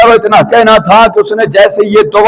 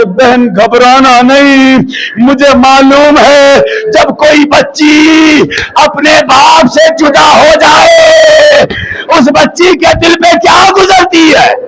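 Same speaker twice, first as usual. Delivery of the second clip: slow, higher, crying consolation; tearful comforting; 5 semitones higher than usual. Retold or imitated. Veiled girl consoles the lost child, crying out. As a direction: comfort the lost sister while breaking into sobs. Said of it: "आके कहती है".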